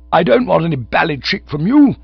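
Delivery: high, loud huffy protest; gurgling indignation. A narrator's reading. Cyril answering back to the child, high indignation. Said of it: "gurgling a bit".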